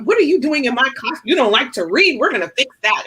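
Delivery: high-pitched, playful teacherly disbelief; mock indignation. Teacher to a student who won't read, mock indignant. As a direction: act out an appalled teacher taking a student on. Teacher acting out scolding a non-reader.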